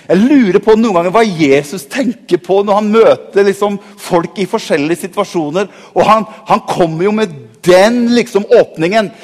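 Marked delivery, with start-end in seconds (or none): none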